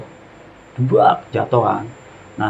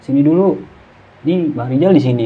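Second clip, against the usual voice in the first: raised, slightly louder call; calling over; 4 semitones higher, 3 dB louder than usual. Retold or imitated. Storyteller calling Nana over to Rizal, lightly acted call. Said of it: "Terus gua panggil".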